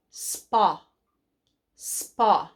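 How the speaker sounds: "Spa" with a short ah; short O blend. Shown as a demonstration. Teacher to kids, modelling the short o in "Spa". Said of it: "Short O".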